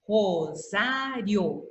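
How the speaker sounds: Rosário drawn out slowly, final I and O together; slow syllables in Rosário, last two vowels joined. Shown as a demonstration. Teacher showing that I and O stay together, slow syllables. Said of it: "O I e o O ficaram juntos".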